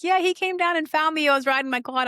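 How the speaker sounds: high-pitched mock teenager; imitating a kid. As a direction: play the excited kid on the quad. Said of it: "some kid's on his quad ... is like".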